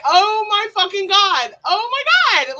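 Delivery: loud, drawn-out outcry; big, loud, high-rising exclamation; exclaiming in disbelief. Retold or imitated. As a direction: wail it out in mock despair. Acted outcry at boss's joke.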